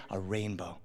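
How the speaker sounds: low, very soft, awed hush; hushed whisper. Retold or imitated. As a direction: whisper it just loud enough to carry. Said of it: "I whisper just loud enough".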